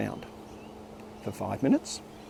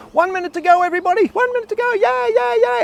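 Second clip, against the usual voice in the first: much higher, hyped-up countdown; much higher, cheering announcement; mock excited cheer; 4 dB louder than usual. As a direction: pump the kids up like a camp leader. Teacher hyping the boys.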